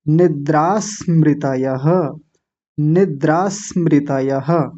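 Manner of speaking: slow निद्रा स्मृतयः, the s linked onto nidra; joined pronunciation. Shown as a demonstration. Teacher making it easier by joining, slow linked निद्रा स्मृतयः. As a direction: join निद्रा स्मृतयः, sounding final ha. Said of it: "अब निद्रा के साथ जोड़ेंगे".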